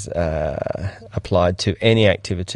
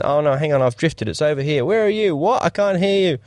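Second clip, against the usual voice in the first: higher, flustered calling out while drifting; mock flustered; 7 semitones higher than usual. Drifting filmmaker calling to crew, mock flustered. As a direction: play lost and confused in the water, voice up high.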